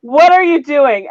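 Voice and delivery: exasperated repeat. Retold or imitated. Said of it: "repeated after laugh".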